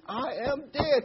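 high, loud stubborn insistence; stubborn old wife. Retold or imitated. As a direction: dig in her heels. Joke, wife insists again.

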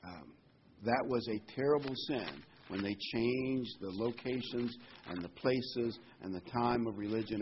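usual speaking voice

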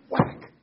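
sharp, loud imitated stick whack; a loud, punchy whack sound; loud mock whack. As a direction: smack the word out like a stick hitting a desk. Teacher hitting fidgeting kids.

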